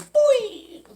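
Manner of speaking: high cartoon boing; imitated punch sound. Storyteller as the fist hits her face, cartoon boing. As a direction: sing out a springy boing.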